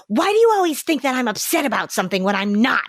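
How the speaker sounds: put-on upset fifteen-year-old. Teen rebuffs a parent's concern, mock indignant. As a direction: protest in a hammed-up teen huff. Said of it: "fifteen-year-old who comes home".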